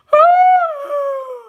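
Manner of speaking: big high-pitched mock yawn; long, showy yawn of boredom; exaggerated loud yawn. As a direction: yawn loudly as if bored stiff. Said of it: "yawn so loud and big".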